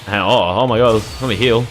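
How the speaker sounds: usual speaking voice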